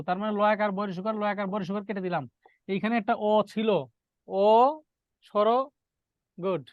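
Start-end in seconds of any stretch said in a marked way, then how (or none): none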